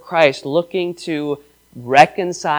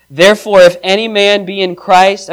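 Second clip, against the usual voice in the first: scripture reading; 3 semitones higher, 5 dB louder than usual. Acted. Reads scripture aloud.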